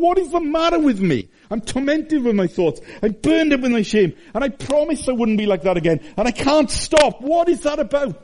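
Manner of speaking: high, pleading cry of torment; anguished self-questioning. Retold or imitated. Preacher voicing a tormented sinner, high anguish. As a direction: voice the sinner's despair, high and strained.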